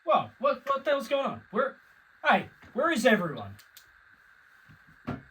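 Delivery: high, startled and unsure; confused. Acted. Anchor alone in the studio, startled questions. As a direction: call out into the dark, bewildered.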